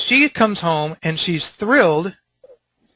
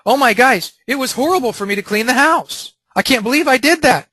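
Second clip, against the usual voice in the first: mock panic; 7 semitones higher than usual. Husband frets over his wife's anger, voice raised in mock panic. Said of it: "I could be thinking".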